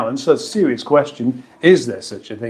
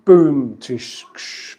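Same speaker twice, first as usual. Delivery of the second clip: spoken rimshot; much slower than usual. Pretend. Imitates a joke rimshot.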